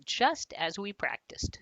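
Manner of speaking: ordinary delivery, nothing marked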